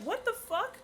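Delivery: retold shock. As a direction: replay the moment of confusion. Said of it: "I was like".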